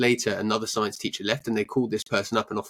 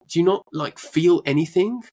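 incredulous; 6 semitones higher, 5 dB louder than usual. Retold or imitated. Incredulous at a flat apology.

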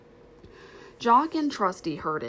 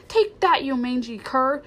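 loud, high furious outburst; aunt attacking the stray dog with her broom; angry cry; 5 semitones higher, 4 dB louder than usual. Acted. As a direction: strike at him with the words. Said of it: "she cried".

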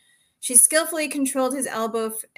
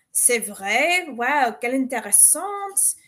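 charming French; slower than usual. A narrator's reading. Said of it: "he chimed with a winning smile".

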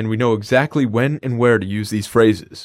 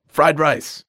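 yelled out; 4 semitones higher than usual. Shown as a demonstration. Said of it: "Just yell it out".

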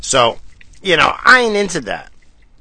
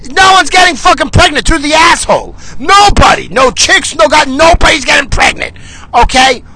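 much higher, louder furious yelling; shouting; 11 semitones higher, 6 dB louder than usual. Real, not acted. Ranting at Harvard, yelling at full volume.

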